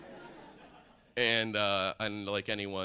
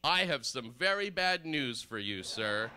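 mock grave announcement; 8 semitones higher, slower than usual. Comic breaks bad news to stranger.

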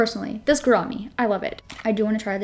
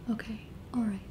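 whispered; 10 dB quieter, faster than usual. Real, not acted. Relieved after placing lash.

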